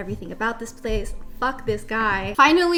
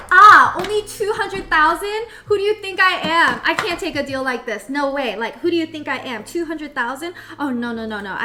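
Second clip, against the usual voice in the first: boastful businessman; 4 semitones higher, 6 dB louder than usual. Retold or imitated. Retelling date's boastful work call.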